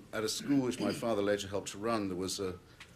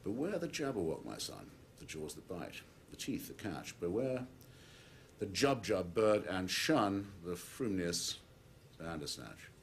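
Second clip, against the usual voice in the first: hushed, drawn-out caution to a son; grave warning. Acted. Father warns his son of the jabberwock, grave warning.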